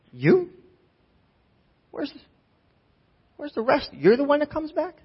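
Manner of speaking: high, hushed incredulous question; acted disbelief. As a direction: ask it as if you cannot believe who showed up. Acting out incredulous Jesus again.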